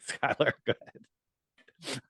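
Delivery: soft, slow words squeezed through laughter; laughing hard. Real, not acted. Host handing over to Skylar, laughing hard. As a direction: barely get it out for laughing. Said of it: "I'm laughing hard".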